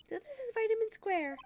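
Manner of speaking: put-on excited young Maya urging Nick on; Maya's voice. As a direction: pitch way up as the eager sidekick. Voicing Maya.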